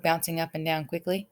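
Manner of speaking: ordinary delivery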